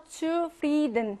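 "zufrieden" with a drawn-out, stressed FRIE; second-syllable stress. Shown as a demonstration. Teacher recapping three-syllable stress, "zufrieden" stressed on FRIE. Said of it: "삼 음절 중에서 이 강세입니다".

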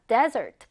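desert with the stress up front on "DES"; teacher's model "desert", strong "des", weak "ert"; stress on first syllable. Shown as a demonstration. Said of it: "앞 음절에 있습니다".

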